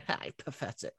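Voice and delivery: very soft, mocking scoff; Tom Cruise. Lestat mocks his attacker mid-fight, scornful. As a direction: sneer it under the breath. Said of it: "Tom Cruise is just laughing".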